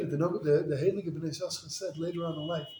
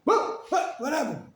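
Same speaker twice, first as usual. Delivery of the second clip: shocked disbelief; 9 semitones higher, slower than usual. Retold or imitated. Shocked father reacting.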